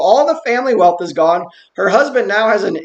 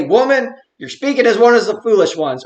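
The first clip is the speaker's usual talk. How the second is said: stern rebuke; 4 semitones higher than usual. Acted. Preacher trying out Job's answer to his wife, stern rebuke. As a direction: put force on "Woman" as a mock reproach. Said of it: "tone and intonation of his voice. Does he say".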